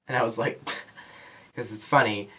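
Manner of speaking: ordinary talk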